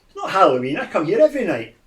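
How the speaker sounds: put-on mildly protesting old gentleman; lost old man. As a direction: lightly play a confused old man. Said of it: "go into lost old man".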